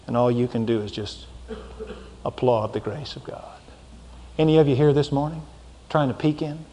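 low, soft, tender invitation; hushed altar call. Real, not acted. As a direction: draw them in quietly.

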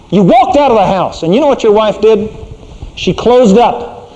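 usual speaking voice

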